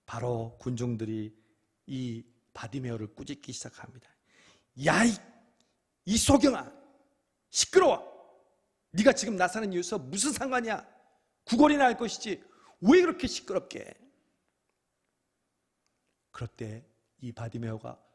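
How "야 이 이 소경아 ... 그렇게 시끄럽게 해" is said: high, loud angry rebuke; scolding crowd. Acted. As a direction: shout him down. Crowd scolding the blind man.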